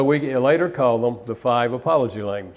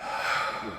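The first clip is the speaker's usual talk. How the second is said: a long, soft, unvoiced sigh; heavy sigh; 10 dB quieter than usual. Real, not acted. Husband realizing he was wrong, heavy sigh. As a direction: let out the breath of a man caught wrong. Finds briefcase he blamed her for.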